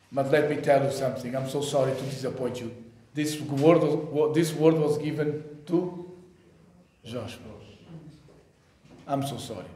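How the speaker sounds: low, hushed letdown; quiet, low-pitched apology; apologetic aside. Real, not acted. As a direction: break it to them softly, dropping away. Gently breaking disappointing news to congregation.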